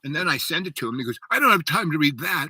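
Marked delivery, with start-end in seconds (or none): 1.3-2.5 higher, louder imitated refusal; mock exasperated friend. Friend refusing to read what was sent, mock exasperation. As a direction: brush it off in a raised, mimicking voice. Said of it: "he, like, loses his mind".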